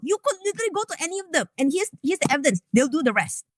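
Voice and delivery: ordinary delivery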